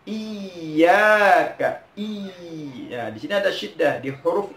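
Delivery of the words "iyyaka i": IYYAKA with the double y held and pressed; correct pressed syllable. Shown as a demonstration. Teacher shows the correct form, pressed double y. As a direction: lean slowly on the "iyy" of Iyyaka. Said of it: "Yang betul seperti apa".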